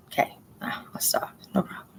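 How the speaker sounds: whispered mock surrender; sarcastic whisper. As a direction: breathe it out, giving in with sarcasm. Sarcastic reply to husband's nagging.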